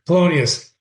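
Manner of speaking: ordinary talk, nothing marked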